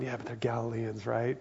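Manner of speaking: a hint of smug superiority, said softly; looking down their nose. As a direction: brush them off as lesser people. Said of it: "looking down their nose on the Galileans".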